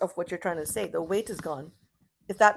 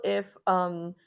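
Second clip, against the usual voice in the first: usual speaking voice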